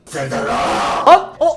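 loud call to a villager. Real, not acted. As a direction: call his name across the field. Calling villager away from danger. (yüksek sesle seslenme)

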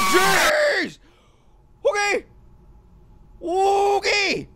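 loud high jump-scare yell; startled scream. Real, not acted. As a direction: shriek at the sudden scare. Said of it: "Why did I scream?".

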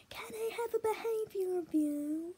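high, drawn-out mock yell to a teacher; imitated yell. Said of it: "I yelled at one of the teachers".

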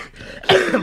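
a real sick cough. Real, not acted. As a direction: cough hard, then recover. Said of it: "I'm sorry, I'm a bit sick".

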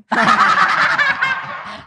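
big squealing laugh; loud high laugh. Real, not acted. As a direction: burst of high-pitched laughter. Laughing at the teasing.

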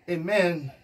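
ordinary talk

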